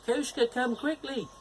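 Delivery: high call. Acted. Dog urges the cat to come quickly, high call. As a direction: urge the cat to hurry in a high voice. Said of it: "the dog called the cat over".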